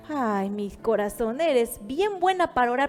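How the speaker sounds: slow, drawn-out indulgent reproach; patient, slowed-down scolding; the Lord gently reproving. Acted. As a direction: chide a dear child, taking your time. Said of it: "Y el Señor".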